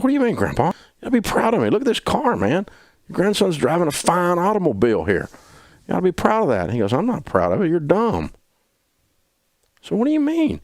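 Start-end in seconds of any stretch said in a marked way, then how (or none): 0.0-6.6 high-pitched, boastful pleading; mock youthful indignation; indignant young protest. Acted protest to disapproving grandpa. 6.9-8.3 high, blunt mock rebuke; scolding grandpa. Grandpa's scolding retort, acted. 9.8-10.6 very high, offended question; incredulous high-pitched comeback; indignant protest. Retold or imitated. Acted protest to grandpa.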